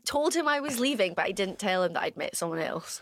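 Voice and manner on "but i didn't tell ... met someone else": ordinary talk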